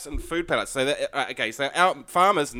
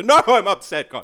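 mock upset; 4 semitones higher, 6 dB louder than usual. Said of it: "this backup excuse of".

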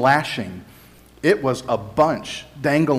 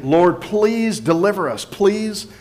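mildly imploring, pitched higher; retold crowd plea; 6 semitones higher than usual. Crowd crying Hosanna to be saved, retold as a raised plea. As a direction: plead on the crowd's behalf, voice lifted. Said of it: "That's what they were shouting".

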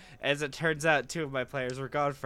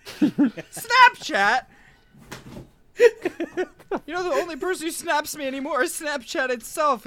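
laughing; 11 dB louder than usual. Real, not acted. Laughing about being snapped.

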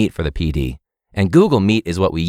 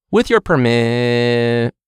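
slowed, breaking-up connection voice; laggy cut-off voice; much slower than usual. Retold or imitated. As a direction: sound like a frozen video call. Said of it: "They're like".

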